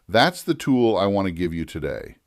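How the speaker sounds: normal talking voice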